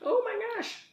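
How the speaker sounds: high strained squeak of effort; struggling hard with a tight lid; opening a stuck jar. Real, not acted. Now I won't be able to open this one.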